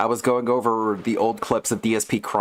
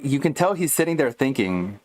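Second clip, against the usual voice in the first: ordinary talk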